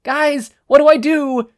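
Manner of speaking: high, loud panicky question; panicked plea. Acted. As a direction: beg for help.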